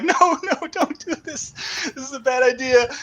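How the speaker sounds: high, pleading wail of dread; mock panicked plea. Retelling the boat ride, pleading with a friend not to talk to the stranger, high and panicky. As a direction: beg them to stop, hammed-up. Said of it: "Tim and I were like".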